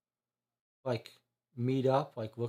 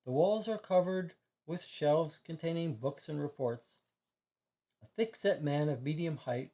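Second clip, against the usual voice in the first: ordinary delivery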